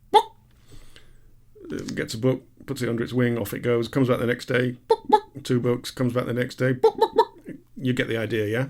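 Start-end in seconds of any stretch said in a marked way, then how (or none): 0.1-0.3 clucked chicken "Book!"; chicken impression. Chicken at the library desk, clucking. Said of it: "your chicken impression is extremely good". 4.9-5.2 high squawking "book, book"; chicken impression. Chicken returns to the library, high squawking clucks. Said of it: "your chicken impression is extremely good". 6.7-7.6 high squawking "book, book"; chicken impression. Chicken returns to the library, high squawking clucks. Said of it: "your chicken impression is extremely good".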